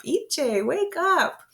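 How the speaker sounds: high, lilting wake-up call; waking a child. Pretend. Parent waking a sleeping child, high drawn-out call. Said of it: "Wake them up".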